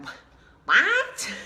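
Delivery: jolted surprise, breathy stop then pop up; half-deaf old lady caught off guard; startled what. Retold or imitated.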